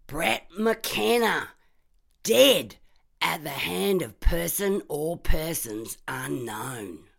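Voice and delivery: slow, loud, high headline reading; dramatic headline. Acted. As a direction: announce the death like a newsreader. Reading headline text aloud.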